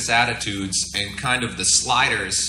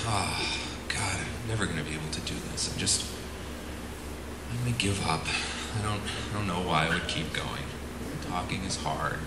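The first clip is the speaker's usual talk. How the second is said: softer, mopey defeated sigh of a voice; Eeyore gloom; 4 semitones lower, 10 dB quieter than usual. Pretend. Speaker mimicking a resigned pilot, softer mopey Eeyore gloom. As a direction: sag into it like Eeyore giving up. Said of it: "I call it the Eeyore complex".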